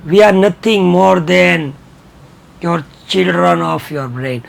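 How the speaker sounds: very slow and quiet, word by word; slow dictation. Shown as a demonstration. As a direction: spell it out slowly for the class. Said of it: "teacher dictates an English sentence".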